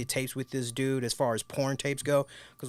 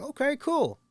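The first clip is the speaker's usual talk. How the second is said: mock casual; 13 semitones higher than usual. His own reply, pitched up.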